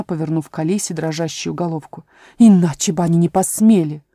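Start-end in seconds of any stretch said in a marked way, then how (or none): none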